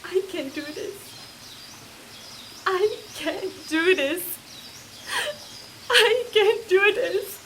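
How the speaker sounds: from a hushed murmur up to a cracking, high cry; despair building. Acted. Repeating the line, escalating despair.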